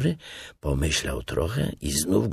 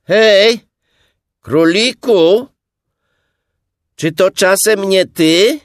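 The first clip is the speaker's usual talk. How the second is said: loud, high holler; high, shouted question; calling into a burrow; 12 dB louder, slower than usual. A narrator's reading. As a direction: call out to someone hidden inside. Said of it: "wsadził łebek do nory i powiedział".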